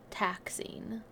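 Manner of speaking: breathy whisper on one word. Acted. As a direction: drop to a whisper. Whispered end of line.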